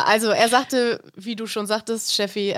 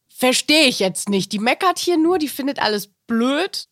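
higher-pitched, exasperated inner voice; indignant disbelief; 4 semitones higher, 3 dB louder than usual. Retold or imitated. Viewer's reaction to the candidate moaning about everything, indignant disbelief. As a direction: voice a baffled complaint, pitch climbing.

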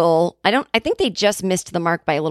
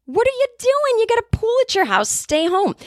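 much higher, piercing exasperated plea; shrieking scold; 14 semitones higher than usual. Retold or imitated. Urging Sarah to stay home, high shrieking scold. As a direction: shriek it at a friend making a bad choice.

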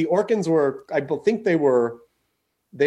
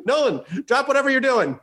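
higher-pitched, urgent mock summons; pretend phone call; 7 semitones higher than usual. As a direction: call out as if barking orders down a phone. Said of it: "let me get Nolan on the phone".

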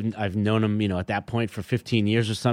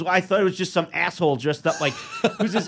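higher, laughing through the words; 7 semitones higher than usual. Retold or imitated. [name] quoted, laughing through it.

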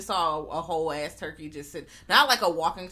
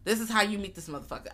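ordinary delivery

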